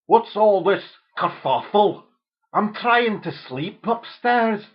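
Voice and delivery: grumpy Scottish father woken, overdone; mock Scottish father. Dad woken upstairs complains in mock Scottish. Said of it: "this is how the Scottish speak".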